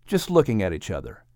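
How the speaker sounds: ordinary delivery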